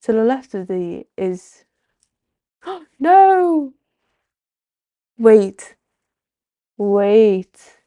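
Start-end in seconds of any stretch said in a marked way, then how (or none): 2.6-3.7 gasp, then a high, loud, stunned no; shocked gasp. Real, not acted. Streamer realizing the stream broke, gasping a loud shocked no. Sudden discovery of display problem. 5.2-7.8 loud, urgent call to stop; alarmed wait. Real, not acted. Streamer panicking about the display, calling a loud urgent wait.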